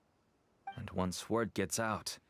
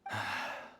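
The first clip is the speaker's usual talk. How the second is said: resigned sigh. Acted. Father gives in before naming others, resigned sigh. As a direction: exhale as you give up resisting.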